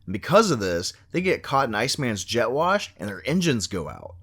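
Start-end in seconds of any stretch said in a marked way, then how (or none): none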